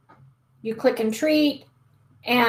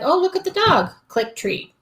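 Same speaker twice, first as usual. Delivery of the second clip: cheery cue; 7 semitones higher than usual. Shown as a demonstration. Cheerful marker cue to dog.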